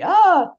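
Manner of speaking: high, loud mock-exasperated "ah"; loud, high yelp of exasperation; exasperated cry. Acts exasperation at inner voice.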